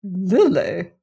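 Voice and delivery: incredulous disdain, pitch swooping up and down; haughty, questioning disbelief; disapproving. A narrator's reading. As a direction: echo the name as if most unsuitable. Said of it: "as if this were a most unsuitable name".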